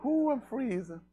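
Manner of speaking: high, drawn-out complaining voice; mock the whiny complainers. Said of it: "they'll be hollering one day".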